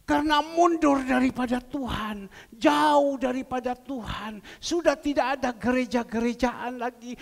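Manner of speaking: loud, forceful sermon warning; preaching. Acted. Preacher warning the congregation about backsliding, forcefully. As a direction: hammer the warning home.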